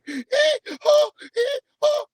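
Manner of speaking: squeaky, very high falsetto chirps; Damien song voice. Acted. Man mocking an alto boy's unbroken voice, squeaky falsetto. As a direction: squeal it far above your own voice. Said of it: "you had the Damien song voice coming out".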